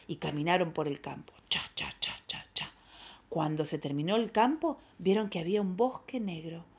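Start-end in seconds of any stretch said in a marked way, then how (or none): 1.5-2.7 voiceless, rhythmic cha-cha steps; hushed stepping noises; whispered walking footsteps. Acted. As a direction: whisper each step evenly.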